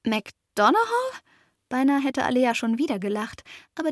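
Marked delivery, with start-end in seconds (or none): 0.0-1.2 amused disbelief. Acted. Girl repeats odd name.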